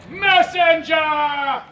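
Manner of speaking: long, loud, drawn-out summons; calling out. Acted. King calls forth his messenger, drawn-out shout. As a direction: holler it and stretch every syllable. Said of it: "he calls forth his messenger".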